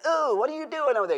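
put-on high, fast teasing child voice; nosy little kids. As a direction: mimic a giggly kid peeking. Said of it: "the little kids go".

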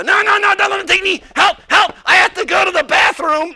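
mock panic. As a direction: squeal and beg not to be taken away. Puppet resists being put away.

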